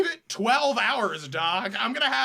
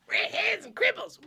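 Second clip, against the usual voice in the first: much higher cartoon-brat impression; Eric Cartman; 12 semitones higher than usual. Friend mocking the redhead remark, very high cartoon-brat imitation. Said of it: "a more Eric Cartman-y thing".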